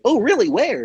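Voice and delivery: high, curious mascot voice; Barney. Retold or imitated. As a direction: ask eagerly in a high character voice. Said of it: "me as Barney is trying".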